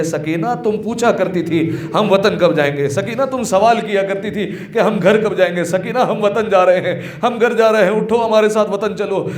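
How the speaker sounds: mother's lament, rising gradually in emotion; retold weeping plea. Mother at her little daughter's grave, retold tearful plea. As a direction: imitate a grieving mother calling to her child. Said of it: "رو رو کر کہتی".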